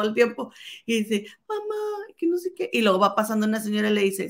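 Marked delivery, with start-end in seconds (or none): 1.5-2.7 put-on high child voice calling mama; high little boy voice; scared kid calling. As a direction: pitch it up like a frightened boy. Scared boy calls mother.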